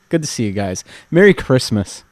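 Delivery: ordinary delivery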